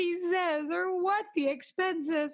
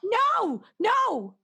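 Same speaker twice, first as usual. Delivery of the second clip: high, urgent no's; snatch at the line in panic; panicked no; 7 semitones higher, 3 dB louder than usual. Acted. Nervous wife pleading with the operator, high panicked protest.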